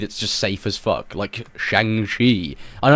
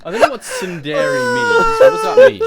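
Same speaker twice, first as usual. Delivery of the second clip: high, loud, laughing through the words; laughing shout; 9 dB louder than usual. Real, not acted. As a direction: shout it while cracking up. Reacting to ASMR titles.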